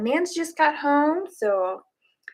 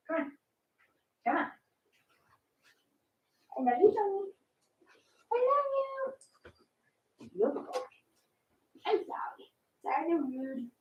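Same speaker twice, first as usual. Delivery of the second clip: cooing to pet; 7 semitones higher, 9 dB quieter than usual. Real, not acted. Doting on her cat Johnny, high soft cooing. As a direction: coo it up high and softly.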